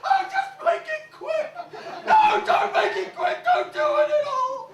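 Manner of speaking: hammed-up terror; panicked pleading. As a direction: beg for your life in a fluster. Butler begs for his life.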